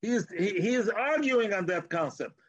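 usual speaking voice

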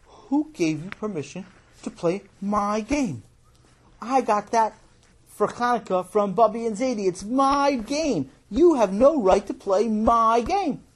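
high, possessive outrage; indignant. Acted. Boy scolding his brother over a borrowed game, indignant. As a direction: lean hard on each claim, building in protest.

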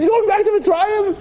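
God scoffing at the people wanting to go back, incredulous. Retold or imitated. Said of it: "Hashem says".

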